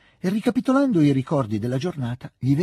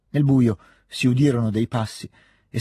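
plain reading voice

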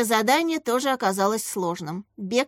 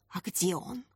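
hushed, softer worried question; frightened; 3 semitones lower, 9 dB quieter than usual. A narrator's reading. Katya learning of a lost tooth, frightened. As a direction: ask it in quiet alarm. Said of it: "испуганно спросила Катя".

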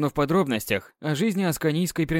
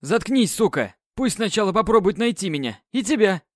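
грубый окрик; 7 semitones higher than usual. Acted. Похититель грубо затыкает королеву.

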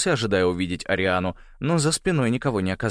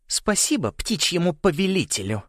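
raised, contemptuous title; all but spit the title; spat out; 9 semitones higher than usual. A narrator's reading. Корделия sneers at the bird lord, spitting it out. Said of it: "Его титул она практически выплюнула".

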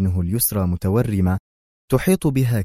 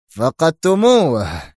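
loud, slow, higher bellow; furious shout; 8 semitones higher, 6 dB louder, much slower than usual. Acted. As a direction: roar it at them. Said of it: "صرخ دون كورليوني بتلك العبارة في سخط هائل".

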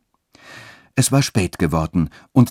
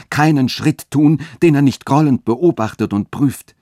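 higher, louder, insistent warning; zealous; 6 semitones higher, 4 dB louder than usual. A narrator's reading. Friedrich warns Katharina of the dean's jealous watch, zealously. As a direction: press her urgently, voice raised. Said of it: "fuhr Friedrich eifernd fort".

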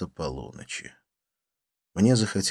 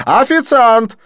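high, loud, insistent call; calling the waiter; 14 dB louder, slower than usual. Acted. Didier calling the waiter again, loud insistent. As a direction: call the waiter once more, louder. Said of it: "окликнул Дидье".

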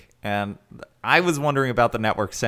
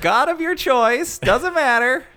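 loud mock announcement; 12 semitones higher, 5 dB louder than usual. Mock orders continue.

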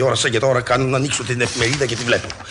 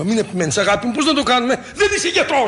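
shouting; 11 semitones higher than usual. Acted. Husband refusing toast from his wife, exasperated shout. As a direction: yell it at her, fed up. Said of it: "μη φωνάζεις, παιδί μου".

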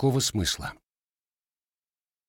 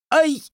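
high, loud yelp; sharp cry of pain; 6 dB louder than usual. A narrator's reading. Grandson yelps when grandfather's hand hits him. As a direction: yelp as if struck. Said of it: "последнее восклицание вырвалось невольно".

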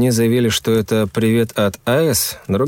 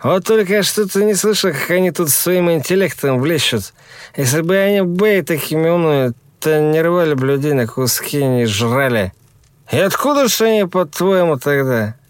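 high, doubting tipsy voice; sceptical young man; 7 semitones higher than usual. Acted. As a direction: poke holes in it, higher. Yura argues with drunk friend.

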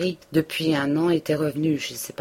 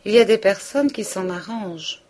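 sly insinuation; 5 semitones higher, 5 dB louder than usual. A narrator's reading. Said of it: "d'un air finaud".

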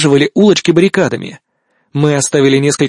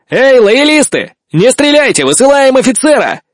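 shouting out a window; 9 semitones higher, 4 dB louder than usual. Acted. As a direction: yell it out to the enemy. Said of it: "Я крикнул в окно". (громкий крик из окна)

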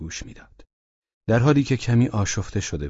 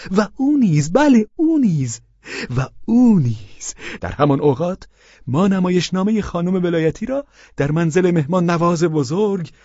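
higher, eager, fawning husband voice; Pavel Pavlovich voiced; 9 semitones higher, 6 dB louder than usual. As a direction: play the meek widower, pitched up. (صدای پاول پاولویچ، زیر و چاپلوس)

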